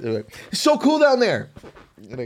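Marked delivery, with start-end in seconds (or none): 0.5-1.5 high, giddy imitation; gushing. Snorkeler reporting back to the non-swimmer, gushing. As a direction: pipe it up in mock wonder. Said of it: "they'd come up and they'd be like".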